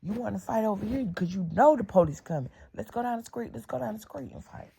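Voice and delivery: high, pushy fight challenge; challenging taunt. Retold or imitated. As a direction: call her out to the street. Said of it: "I'm like".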